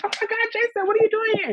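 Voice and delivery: high squealing delight; surprised. Retold or imitated. Meeting Jason in the audience, squealing with surprise. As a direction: shriek his name in happy shock.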